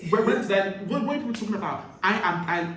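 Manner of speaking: loud, high indignant disbelief; mock-offended protest at the teasing; a loud laughing are-you-serious; playful outrage. Real, not acted. Indignant at being called Pidgin speaker.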